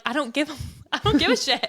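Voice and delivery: breaking into laughter on the swear; mock gruff line that dissolves in giggles; laughing quote. Quoting him, laughing.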